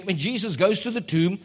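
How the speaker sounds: usual speaking voice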